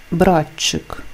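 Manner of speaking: «братчик» with тч as one long ch; lengthened ch. Shown as a demonstration. Teacher gives an example of the rule, lengthened ch. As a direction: hold the ch in «братчик», no separate t. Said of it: "звучить як подовжений".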